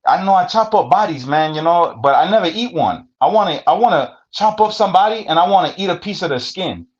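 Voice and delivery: high, far-off mock voice of the Salvadoran inmate in broken English; imitating his accent. Retelling a cellmate's words to the host, high mock accent. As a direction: pitch it up high and foreign, like the little homie. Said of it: "that's how he talk".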